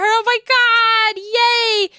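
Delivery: high, thrilled cheering; excited cheer. Real, not acted. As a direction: cheer with delight. Thrilled chat got Vivian.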